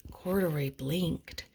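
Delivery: usual speaking voice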